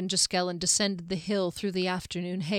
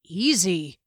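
loud, firm command to calm down; cutting warning; 6 dB louder than usual. A narrator's reading. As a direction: cut through the tension sharply. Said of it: "voice sliced through the tension".

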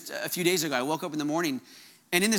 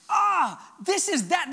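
higher-pitched burst of excited recognition; excited realization; 5 semitones higher, much slower than usual. Retold or imitated. Preacher reliving his dream about the kingdom, excited realization. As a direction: exclaim it as a sudden happy discovery. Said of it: "I was getting excited".

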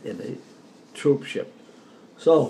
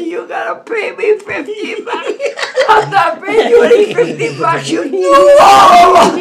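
high, loud, laughing yell for the money; cracking up while shouting the demand; laughing mock angry shout; 12 dB louder than usual. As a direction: demand your winnings through laughter.

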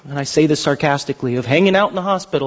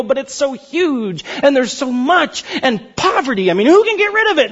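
whiny excuse; 8 semitones higher than usual. Retold or imitated. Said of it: "And they go".

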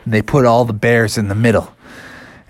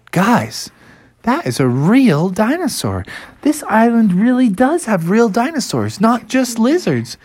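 put-on higher, excited young bear; amazed discovery; 9 semitones higher than usual. Bear telling the family about real dinosaurs, amazed. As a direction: marvel to the others in a raised pitch.

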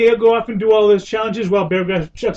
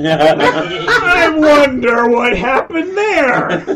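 very slow, drawn-out mock wonder; Bear Grylls; 3 semitones higher, much slower than usual. Mocking a survival host's frozen fish, hammy impression. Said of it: "when I saw him".

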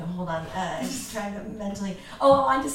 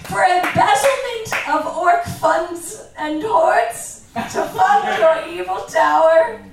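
much higher and louder, slow, a mock police raid; hammy cop bust; 9 semitones higher, 10 dB louder, slower than usual. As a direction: burst in as an over-the-top cop, laughing through it. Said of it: "little bit of acting I'm gonna do here".

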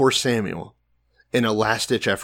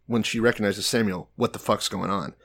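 ordinary delivery

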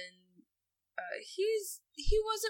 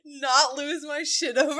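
normal talking voice

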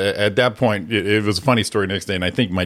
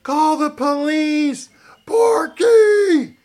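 high, panicked scream for help; mom screaming; 3 dB louder, much slower than usual. Retold or imitated. Mom screams at her son during a break-in, panicked high shriek. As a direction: shriek it like a terrified mom in the night. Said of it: "she starts screaming".

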